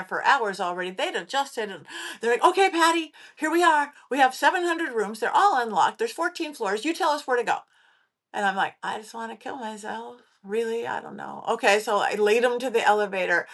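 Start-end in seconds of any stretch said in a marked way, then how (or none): 2.4-7.6 lightly voiced eager crew, higher pitch; crew briefing her. Imitating the waiting crew addressing the psychic, lightly raised and businesslike. 8.8-11.4 softly retold hopeless reply; subdued despair. Replying to the crew at the hotel, quietly dejected.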